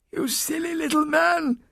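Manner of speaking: put-on very high, strained old-woman voice; croaking old nun. A narrator's reading. Half-strangled nun scorning her attacker, high croak. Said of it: "she croaked".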